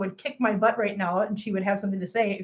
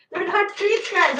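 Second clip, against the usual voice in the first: 1 laughing; 7 semitones higher than usual. Real, not acted. Laughing at cats stealing treats.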